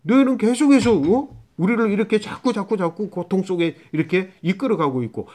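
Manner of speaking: high, lightly acted accusation; a touch of aggrieved protest; grumbling Israelites. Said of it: "그렇게 지금 이스라엘 백성들이 생각하는 거야".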